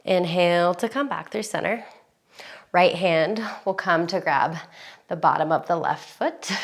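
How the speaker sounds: calm yoga instructor. Acted.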